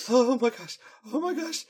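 high breathless oh my gosh; overjoyed gasp. Acted. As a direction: squeal with delight. Rarity overjoyed.

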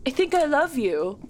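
pouty, breathy film-star line; a light take on her pouty face; Keira Knightley impression. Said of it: "that's my Keira Knightley impression".

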